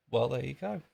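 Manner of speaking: ordinary talk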